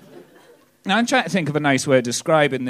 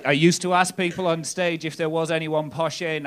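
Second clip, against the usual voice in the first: normal talking voice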